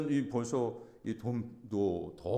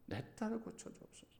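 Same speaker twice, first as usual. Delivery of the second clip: soft plea; 11 semitones higher, 11 dB quieter than usual. Retold or imitated. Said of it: "간절함".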